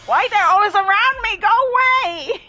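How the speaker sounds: high, loud squeal of panic; panicked yell. Real, not acted. Gamer yelling at the killer chasing her.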